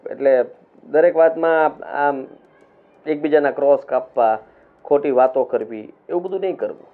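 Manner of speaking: normal talking voice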